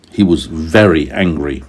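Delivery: plain reading voice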